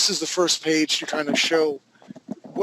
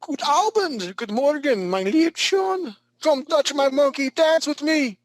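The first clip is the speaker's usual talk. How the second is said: high-pitched, hammed-up German show host; comic German TV host voice, pitched way up; mock German accent; 10 semitones higher, 3 dB louder, slower than usual. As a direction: greet them in a silly high German accent. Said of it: "Welcome to Sprockets".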